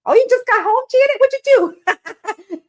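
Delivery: thrilled surprise at a friend's news; surprised delight. Real, not acted. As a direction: squeal it out, amazed and amused. Surprised by viewer's comment.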